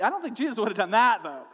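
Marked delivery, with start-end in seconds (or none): none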